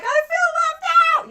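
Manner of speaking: high, loud mock whine; whiny left out. Libras complaining they were forgotten, a high loud whine. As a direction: squeal it like a sulking kid. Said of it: "every single Libra was like".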